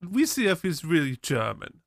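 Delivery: hammed-up Hans and Franz German; comic German accent with hard consonants; mock German accent. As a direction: imitate a bad German actor. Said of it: "very good Hans and Franz accent".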